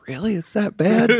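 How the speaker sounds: shocked high "really?"; rising disbelief; surprised. Retold or imitated. Shocked reaction in dream.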